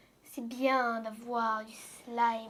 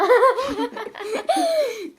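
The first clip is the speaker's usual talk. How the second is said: grand rire aigu; éclat de rire; 10 semitones higher, 12 dB louder than usual. Real, not acted. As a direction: rire franchement aux éclats. Fou rire en fin de vidéo. (éclat de rire spontané)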